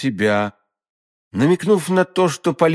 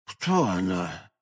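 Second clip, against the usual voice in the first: slow, soft, lower-pitched asking; quiet question; 7 semitones lower, 8 dB quieter, slower than usual. A narrator's reading. Innkeeper questioning the fainting youth, quietly. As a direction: ask it gently and low.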